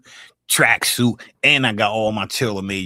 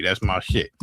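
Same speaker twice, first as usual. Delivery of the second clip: ordinary talk, nothing marked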